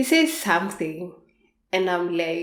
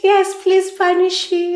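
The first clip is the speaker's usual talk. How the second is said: mock eager; 10 semitones higher, 6 dB louder than usual. Playfully replying to lover's threat.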